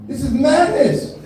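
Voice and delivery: high, slow, appalled exclamation; hammed-up disbelief; mock outrage. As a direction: draw it out high in comic dismay. Acting reaction to watching the dancing.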